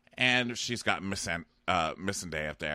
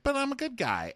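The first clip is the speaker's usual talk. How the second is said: whiny mock Jaime. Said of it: "you're like".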